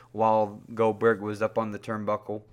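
ordinary talk

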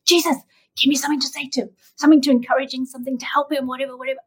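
high, urgent retold plea to God; frantic prayer. Patient begging Jesus for something to say, frantic high-pitched prayer. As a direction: re-enact praying desperately, pitched well up. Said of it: "I'm praying like crazy".

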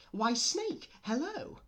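high squeaky greeting; high mouse voice; chirpy mouse. Acted. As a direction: squeak a cheery hello.

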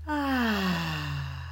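long exhale sighed out aloud; loud audible sigh. Shown as a demonstration. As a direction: breathe out with a big audible sigh. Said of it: "release with a loud, audible sigh".

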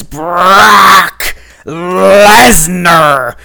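dragged-out shouted Brock Lesnar; announcing his client with a huge slow shout; Paul Heyman impression. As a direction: bellow the name very slowly, like an announcer. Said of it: "That impression was embarrassing".

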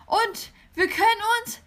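building to a high, loud scream; high screaming YouTuber hype; hyped-up shriek. Acted. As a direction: shriek with over-the-top excitement. Hyped celebration of a big TV.

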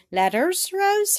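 "Letters, Rose?" as a question, leaping high on Rose; inquiring. A narrator's reading. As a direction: ask it lightly, lifting "Rose" sharply upward. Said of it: "her husband said inquiringly".

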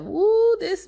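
high, loud whoop of joy; a burst of giddy cheer; delighted woo. Pretend. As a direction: let it fly up like she's giddy. Voicing Grace's delight on date.

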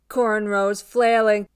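plain reading voice

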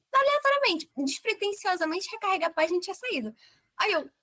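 ordinary talk

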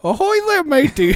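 high, loud pirate-like Irish shout; hammed-up, high-pitched bad Irish voice; Conor McGregor. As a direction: holler a badly faked Irish greeting. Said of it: "I can't even do a good Conor McGregor".

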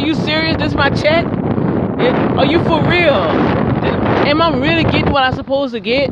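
high, stunned questions; disbelieving joy. Retold or imitated. Opening the big bonus envelope, high stunned disbelief. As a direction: ask it like you can't believe your luck. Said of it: "I started crying".

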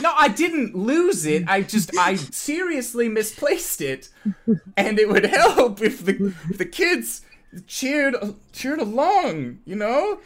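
high, protesting showman voice; flustered, defensive manager. Acted. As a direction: insist in a high voice, scrambling to save face. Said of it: "as he's kind of quickly talking".

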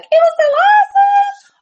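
very high, loud excited squeal; hammed-up ecstatic shout; imagined gushing mom. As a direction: shriek it like an over-the-top thrilled fan. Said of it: "either she was gonna be like".

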